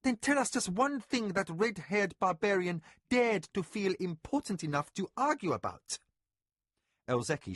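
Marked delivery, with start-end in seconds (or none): 0.0-6.0 higher, sharp commanding mistress; sharp snap. A narrator's reading. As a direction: snap the order at the servant. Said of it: "Mara snapped at the overseer".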